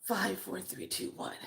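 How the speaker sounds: hushed, soft count down; softly murmured, fading towards a whisper; calming countdown. Acted. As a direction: count yourself down under your breath.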